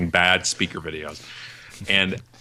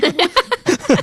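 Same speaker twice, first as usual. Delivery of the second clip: much higher, louder astonished what; mock disbelief; 4 dB louder than usual. Speaker reacting to clients, hammed-up disbelief. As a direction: react as if you can't believe your ears. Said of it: "I'm like".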